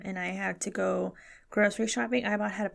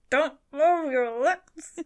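slow, higher mock mumble through the teeth; talking through teeth; 5 semitones higher, much slower than usual. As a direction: stretch it out without moving your lips. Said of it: "Just talks through your teeth".

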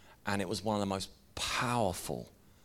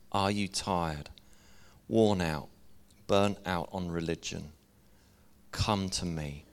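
scripture reading; 4 semitones lower than usual. Acted. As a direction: read it like a gentle invitation from Jesus. Reads scripture passage aloud.

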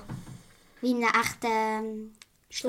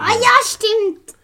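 loud high sudden recall; excited; 10 semitones higher, 9 dB louder, slower than usual. Real, not acted. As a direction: burst out remembering. Child suddenly remembers.

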